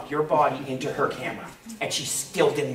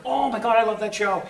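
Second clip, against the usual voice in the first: mock gushing fan, pitched up; excited fan; 6 semitones higher than usual. Fans spot the Stargate actor, imitated excited gushing. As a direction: gush like a starstruck fan.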